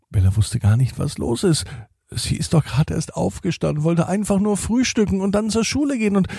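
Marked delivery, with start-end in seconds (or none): 2.1-6.3 flustered. Acted. Bella's flustered thoughts.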